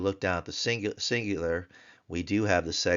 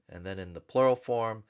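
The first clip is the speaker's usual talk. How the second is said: usual speaking voice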